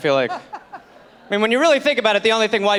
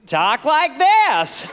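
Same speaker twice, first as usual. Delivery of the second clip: put-on slow, drawn-out American accent, pitched up; new American accent; 4 semitones higher, much slower than usual. Colonist unveiling a new way to talk, slow exaggerated American accent. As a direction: stretch it into exaggerated American vowels. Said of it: "talk entirely differently".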